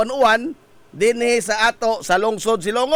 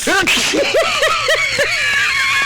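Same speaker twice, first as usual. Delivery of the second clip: shrieking laugh. Real, not acted. Co-host laughs at the storm joke, shrieking laugh.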